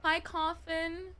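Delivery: high, slow, sing-song hello; cutesy greeting voice. Acted. Streamer greeting a chatter in a slow cutesy put-on voice. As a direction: stretch the hello out sweetly.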